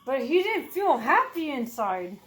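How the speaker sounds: usual speaking voice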